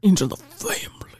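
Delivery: hushed echo in a whisper. Pretend. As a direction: whisper it back playfully. Echoes partner in whisper.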